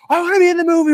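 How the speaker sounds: very high, loud, hammed-up eager squeal; mock group frenzy of excitement; freaking out. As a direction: beg to be picked like an overexcited fan. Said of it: "We're freaking out".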